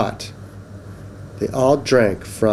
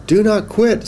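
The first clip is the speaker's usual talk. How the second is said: high, emphatic cheer-on; urging encouragement; 9 semitones higher, 3 dB louder than usual. Acted. Pup urges Duck to keep trying, encouraging. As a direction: urge them on in a higher voice.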